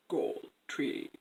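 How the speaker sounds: low, soft, whispery fish voice; hushed deep oracle fish; fish's reply. Acted. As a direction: murmur it very low, almost voiceless. Fish answers the queen.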